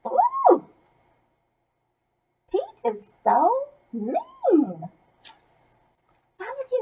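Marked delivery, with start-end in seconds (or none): 0.1-0.7 a sharp high gasped ooh. Real, not acted. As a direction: squeal it out, scandalised. Reacting to mean Pete.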